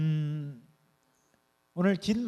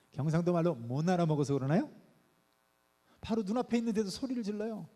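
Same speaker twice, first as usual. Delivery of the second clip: usual speaking voice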